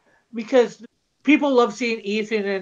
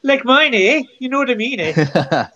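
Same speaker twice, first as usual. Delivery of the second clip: louder, exaggerated Canadian "eh?" accent; hammed-up Canadian accent; 5 semitones higher, 5 dB louder than usual. Canadian guest mocking his own accent, loud and hammy. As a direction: lay the Canadian accent on thick, playing up the "eh". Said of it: "not as strong as some Canadian accents I know".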